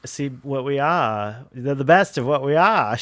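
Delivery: slow, flat Mid-Atlantic delivery; flat Mid-Atlantic accent. Retold or imitated. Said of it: "flat Mid-Atlantic".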